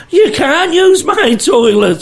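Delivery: put-on high, slow, outraged old lady; indignant old woman. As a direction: pitch it up and drag out the refusal. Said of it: "This old woman's like".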